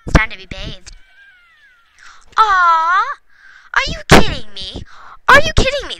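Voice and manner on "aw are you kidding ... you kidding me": loud, high cry of disbelief; shocked outburst. Real, not acted. Gamer reacting to a broken scratching post, loud and shocked. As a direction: yelp in outraged surprise.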